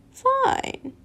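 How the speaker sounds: high sneering Voldemort squeak; villain voice. Acted. Voldemort coldly dismissing Lucius's refusal. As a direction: toss it off with cold menace.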